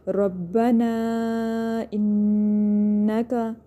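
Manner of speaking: very slow chant with ربنا held long at its end; elongated recitation. Shown as a demonstration. Teacher shows students the madd, reciting ربنا with a long final vowel. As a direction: draw out ربنا, then hum the doubled noon in انکا. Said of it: "اس کو ہم لمبا کریں گے".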